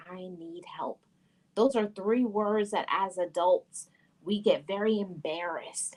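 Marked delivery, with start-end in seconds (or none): 0.1-1.0 an unvoiced hush on the plea; whispered plea. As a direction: breathe it out like a confession. The words adults struggle to say.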